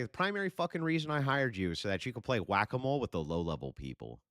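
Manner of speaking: a hint of boss barking; retold instruction to Rob. Streamer retelling his order to Rob, emphatic. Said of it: "Told him. It's like".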